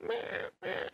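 whispered little cat sounds; breathy imitation of the cat; cat noise done under the breath; mock cat noise. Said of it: "it's just there, like".

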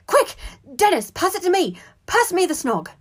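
high, loud frantic plea; urgent panic. A narrator's reading. Brother begging the wailing boy to pass it, frantic. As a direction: urge him on desperately. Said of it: "In an utter panic".

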